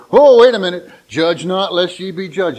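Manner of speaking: loud, high "oh", then easing; mock objection. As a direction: cut in loudly like someone quoting scripture back. Acts out people throwing verse back.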